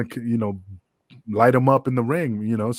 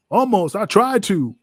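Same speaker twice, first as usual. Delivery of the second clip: higher, louder gloating; defiant boast; 8 semitones higher, 5 dB louder than usual. Retold or imitated. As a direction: crow about it proudly and loud. Jack boasting about attack.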